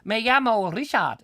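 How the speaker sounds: high, hammed-up fake Spanish gibberish; mock Spanish accent. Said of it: "I gave them that strange accent".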